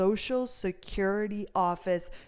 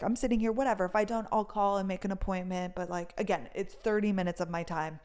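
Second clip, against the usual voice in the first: ordinary delivery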